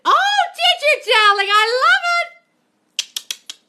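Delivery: very high, slow, laughing gush; laughing delight. Real, not acted. Coach thrilled by a viewer's success, high and laughing. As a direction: stretch it out in squealing joy.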